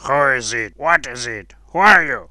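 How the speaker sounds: loud cries from a crowd; crying out. A narrator's reading. Many voices question the unknown boy, crying out loudly. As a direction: call out each question forcefully. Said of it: "cried many voices".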